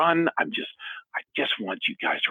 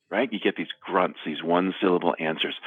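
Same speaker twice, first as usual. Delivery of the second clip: ordinary talk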